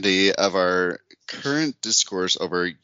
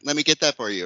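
higher, sweetly helpful quote; imitated little sister; 6 semitones higher, faster than usual. Host imitating her taller sister offering help, higher voice. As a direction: lift the voice in a mock offer. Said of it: "She's like".

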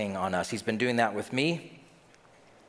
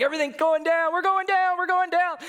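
high, loud, frantic wailing; mock terror, voice shooting way up; hammed-up panic; 5 dB louder than usual. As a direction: cry it out like a hysterical passenger. Acts panicked passenger in turbulence.

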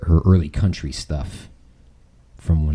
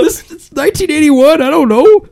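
high loud squeal; mock panicked doctor; 10 dB louder than usual. Friend joins the doctor impression, high and loud. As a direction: chime in as the flustered doctor.

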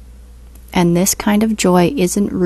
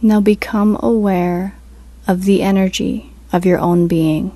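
guided meditation; 4 dB quieter than usual. Acted. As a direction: ease them into stillness, unhurried.

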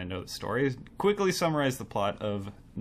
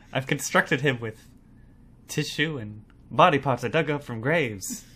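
lightly voiced thirties mad scientist; Henry Frankenstein; 3 semitones higher than usual. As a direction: play a proud old-movie scientist. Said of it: "your Henry Frankenstein".

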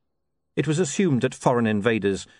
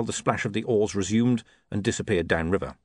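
plain reading voice

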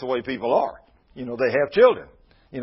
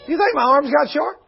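much higher, louder mock question; God mocking question; 13 semitones higher, 5 dB louder than usual. God scoffing at Moses' doubt, high and loud. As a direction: fling God's question out high. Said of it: "He says".

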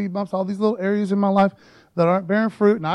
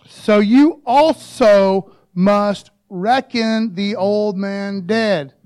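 slow Sling Blade voice, loud and dragged; hammed-up Sling Blade drawl; 7 dB louder, much slower than usual. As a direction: drag it out like Sling Blade, louder. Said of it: "Everyone do the sling, sling blade voice".

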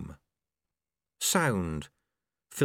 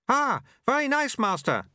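very high, chirpy character voice; eager apprentice; 13 semitones higher, 4 dB louder than usual. A narrator's reading. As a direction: pipe up admiringly to the master, pitched way up. Eager apprentice in time loop.

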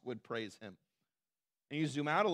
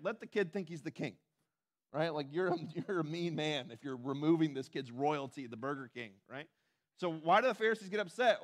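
normal talking voice

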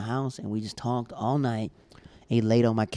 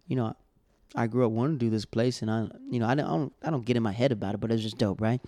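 ordinary delivery

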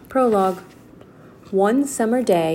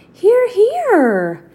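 excited calling; 13 semitones higher, 8 dB louder than usual. Acted. Annie calls from the treehouse.

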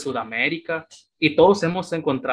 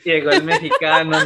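laughing self-mockery; 6 dB louder than usual. Real, not acted. Self-mocking arrival of the Mexican.

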